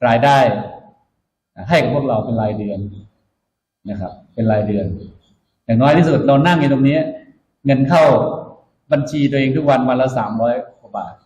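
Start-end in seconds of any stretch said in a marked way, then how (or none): none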